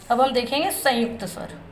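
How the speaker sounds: normal talking voice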